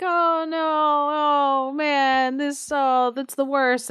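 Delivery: high, drawn-out mock wail; hammed-up whining lament; mock crying complaint. Villagers lamenting beside the garden wall, mock crying and complaining. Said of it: "crying and complaining".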